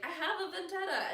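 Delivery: high, laughing mock boy's vow; hammed-up little hero; mock declaration.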